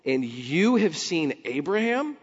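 scoffing temple leaders. Retold or imitated. Leaders scoffing at Jesus, high and incredulous. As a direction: scoff at him in disbelief. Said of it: "They say".

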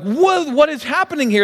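high-pitched stunned question; acted bewilderment. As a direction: play someone baffled by their own luck. Acting winner stunned by matching numbers.

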